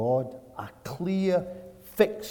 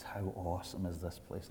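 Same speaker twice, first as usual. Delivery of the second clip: very soft and low; hushed awe; 4 semitones lower, 15 dB quieter than usual. Retold or imitated. Preacher retelling Jacob's early morning words, hushed awe. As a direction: quote it quietly, far down in pitch.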